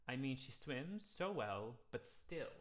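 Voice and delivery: slow, still pushed off the beat; accent on still. Shown as a demonstration. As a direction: lean on still. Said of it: "accent on the word still".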